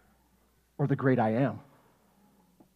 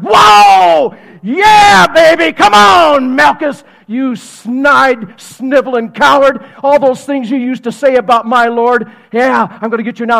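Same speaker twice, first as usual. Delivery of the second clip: loud, high whooping taunt; fired-up battle shout; 6 semitones higher, 12 dB louder, slower than usual. Acted. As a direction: hype yourself up to rumble. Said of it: "He's like".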